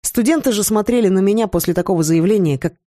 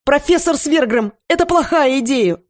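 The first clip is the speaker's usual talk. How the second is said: yelling; 8 semitones higher than usual. A narrator's reading. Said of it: "завопила я".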